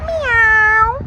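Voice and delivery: high, loud playful meow; mock cat meow. Vlogger jokes about eating cat food, high loud meow.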